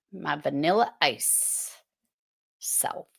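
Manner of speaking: "self" whispered, the rest soft; fading to whisper. Real, not acted. Teacher joking about herself, whispering. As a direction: trail off into a whisper. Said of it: "Just finding my".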